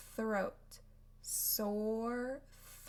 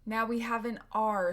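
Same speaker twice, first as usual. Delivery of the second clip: ordinary delivery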